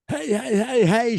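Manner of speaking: high, loud mock shout of hey; mock yell. Host reading the quote, a mock yell of hey. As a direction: call out hey like a singer stopping a fight. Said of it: "she exclaimed".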